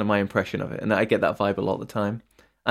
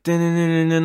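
imitated guitar chugging; 6 semitones higher, 5 dB louder than usual. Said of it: "on guitar".

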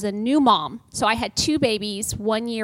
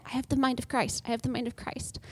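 softer, dazed repetition of scripture; murmured mantra; 8 dB quieter than usual. Retold or imitated. New mom in a grocery store, murmuring a dazed mantra. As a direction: mumble it half-asleep like a zombie.

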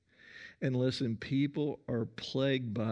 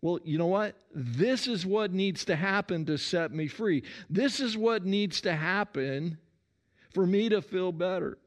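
higher, eager, pressing her demand; Martha pleading; 6 semitones higher, 3 dB louder than usual. Acted. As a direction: insist this is what will set her free. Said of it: "she tells Jesus".

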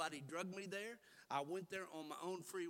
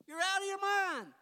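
loud scolding; 18 dB louder than usual. Acted. Repeat of scolding.

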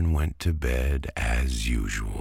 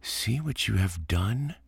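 high, scolding big-brother tone; bossy little princess; 7 semitones higher than usual. A narrator's reading. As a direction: tell her off, high. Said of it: "cried Antoine".

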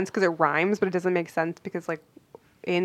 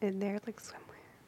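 barely audible whisper to yourself; whispered musing; 15 dB quieter than usual. Real, not acted. Puzzled friend trying out the catchphrase, whispering. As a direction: whisper it, testing out the phrase. Tries the puzzling phrase to herself.

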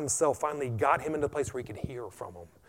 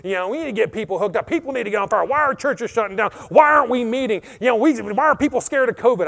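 much higher and louder, shouting; irritated complaint; 9 semitones higher, 10 dB louder than usual. Retold or imitated. Pastor retelling his complaint to the Lord, shouting. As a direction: vent the frustration at God. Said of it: "I was complaining to the Lord".

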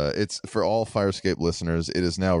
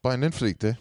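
Brandonflykte higher, in a lilting mock Norwegian accent; 5 semitones higher, slower than usual. Shown as a demonstration. Friend echoing the word, light mock Norwegian accent. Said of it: "as if I think that's what Norwegian accents".

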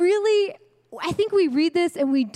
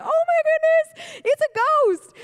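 much higher, louder, hammed-up panicked squeal; mock terrified scream; 4 dB louder than usual. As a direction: play the frightened disciples shrieking like little girls. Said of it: "I'm sure they screamed like little girls".

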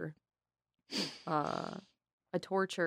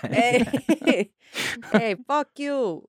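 slow, louder, higher mock New York tough guy; New York accent; 7 semitones higher, 6 dB louder, much slower than usual. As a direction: ham up a New York mobster brushing someone off. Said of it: "what's your best New York accent?".

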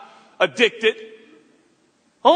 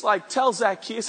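ordinary delivery

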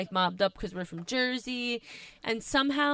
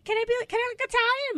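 eager pleading; 4 dB louder, faster than usual. Retold or imitated. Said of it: "And I'm like".

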